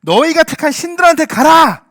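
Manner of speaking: loud, high, slowed-down command; stern rebuke. Acted. Preacher voices God sending Israel away, stern rebuke. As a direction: proclaim the dismissal at full force.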